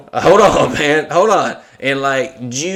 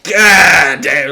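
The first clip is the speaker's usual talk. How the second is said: loud impressed oath; 4 semitones higher, 8 dB louder than usual. Retold or imitated.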